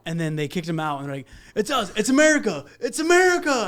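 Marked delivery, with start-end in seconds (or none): 1.6-3.7 high, loud mock rallying cry; retold yelling. Imitating rescuers kicking in and announcing themselves, high yelling. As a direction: yell it out, climbing higher each time. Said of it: "they were yelling".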